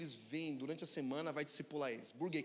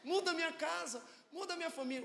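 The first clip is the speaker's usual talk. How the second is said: much higher, pleading prayer voice; mock pleading prayer; 9 semitones higher than usual. Preacher mimicking a believer begging God to fix his home, pleading. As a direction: beg God like a desperate believer. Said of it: "querer orar pra Deus".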